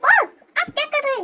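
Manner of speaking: high-pitched, startled question; shocked. Acted. Miss Jin to boss who walked in, shocked. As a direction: pitch it up in alarm.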